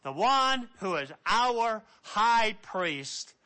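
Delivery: usual speaking voice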